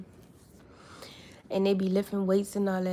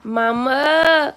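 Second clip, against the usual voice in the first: loud, higher, drawn-out word; 5 semitones higher, 10 dB louder than usual. Real, not acted. Mother models the word for her toddler, loud sing-song coaxing.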